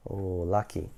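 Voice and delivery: ordinary delivery, nothing marked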